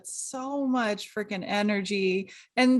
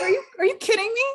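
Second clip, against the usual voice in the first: incredulous retort; 9 semitones higher, 3 dB louder, much faster than usual. Retold or imitated. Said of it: "And I'm like".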